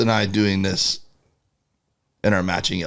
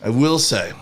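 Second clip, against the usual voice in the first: usual speaking voice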